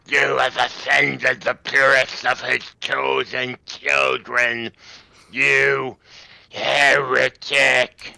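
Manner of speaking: loud, slow, creaking mutant cry. Acted. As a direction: rasp it out like a raving mutant preacher. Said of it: "He utters in a creaking voice".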